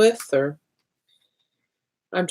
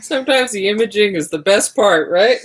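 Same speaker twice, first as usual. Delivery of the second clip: laughing; 7 semitones higher, 5 dB louder than usual. Real, not acted. Shared joke about the imaging.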